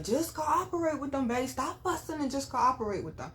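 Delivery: high-pitched, urgent call without real volume; mock yell. Woman calls to her fiance from police car, mock yell. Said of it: "I yelled out the window".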